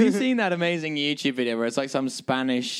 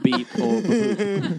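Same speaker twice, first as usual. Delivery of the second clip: slow, higher beep and boop; robot noises; 4 semitones higher, much slower than usual. Pretend. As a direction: say it like a little robot. Mock robot speech.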